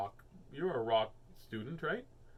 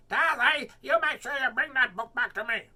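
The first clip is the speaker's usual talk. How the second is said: high, loud mock Yasminka voice; a not very good impression of a friend; imitating Yasminka; 13 semitones higher, 12 dB louder than usual. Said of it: "That's not a very good impression".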